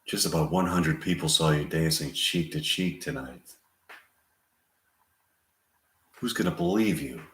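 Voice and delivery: soft, subdued worry; understated fear, kept quiet; hushed, mildly scared warning; Susan Sarandon scared. Acted. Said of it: "Sarandon scared".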